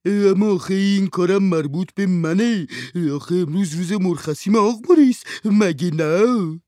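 high, loud whining servant's voice. A narrator's reading. Servant Handsome answers his master, high and whining. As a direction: complain at being called on your day off.

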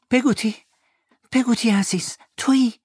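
put-on high boyish voice asking; the boy hoping it's her; child calling. David asks Peggotty through the door in a high young voice.

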